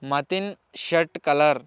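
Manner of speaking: Matin shirt colour said again very slowly, word by word; slow repeat. Shown as a demonstration. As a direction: spell it out slowly so they catch it. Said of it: "వినబడతలేదట".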